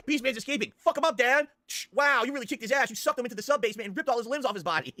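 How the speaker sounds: high, loud hammed-up kid; excited kid voice. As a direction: squeal it like an overexcited child. Mocking the commercial kid.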